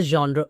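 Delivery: "genre" as ZHON-druh within the sentence; correct pronunciation. Shown as a demonstration. Teacher rephrasing the sentence, "genre" ending in uh. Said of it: "if I have to rephrase the sentence".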